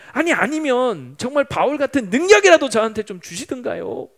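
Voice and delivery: resentful complaint. Retold or imitated. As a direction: pour out your grievance, building to a heated demand. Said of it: "원망이 하나님께 막 쏟아지기 시작하더라는". (원망을 쏟아내듯)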